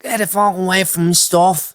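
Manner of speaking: high, loud North Side Dublin shout; North Side accent. Pretend. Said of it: "in a North Side accent".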